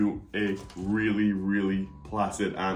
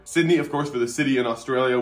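proud announcement; 4 semitones higher, 7 dB louder than usual. Real, not acted.

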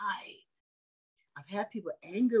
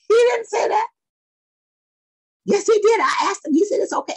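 raised, loud and high, arguing back; retold heated exchange; 11 dB louder than usual. Preacher retelling argument with young lady, loud and high. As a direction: insist loudly that he never said it.

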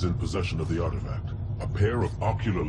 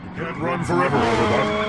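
higher and louder, taunting the fleeing enemy; menacing shout; 11 semitones higher, 6 dB louder than usual. Acted. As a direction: yell it after him like a hunter closing in. Robot shouting after fleeing Autobot.

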